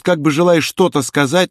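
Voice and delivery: plain reading voice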